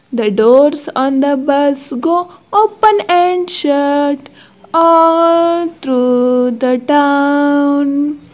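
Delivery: ordinary delivery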